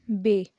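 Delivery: बे said as a long ए, like bay; bay sound. Shown as a demonstration. Teacher modelling the word बे for students. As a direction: say बे with an open ए vowel. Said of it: "बोलेंगे कैसे?".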